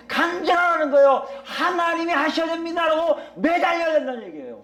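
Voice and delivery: high, insistent sermon voice; urgent exhortation to cry out to God; impassioned preaching. Acted. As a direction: drive each phrase home at a high pitch. Preacher urging people to cry out.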